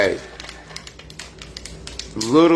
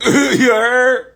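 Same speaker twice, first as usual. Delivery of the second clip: high, loud laughing call-out; laugh, then holler it way up high; emphatic call-out; 13 semitones higher, 6 dB louder than usual. Real, not acted.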